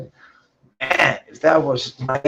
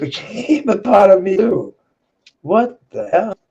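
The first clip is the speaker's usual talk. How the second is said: higher, laughing through the words; 8 semitones higher, 5 dB louder than usual. Real, not acted. Laughing at himself while telling a friend. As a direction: laugh it off in disbelief. Laughing at his own past.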